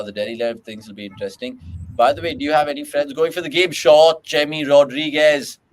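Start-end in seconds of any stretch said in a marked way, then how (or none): 3.7-5.6 loud, excited burst on the shot; excited shot call. Real, not acted. Commentator calling a boundary, loud and excited.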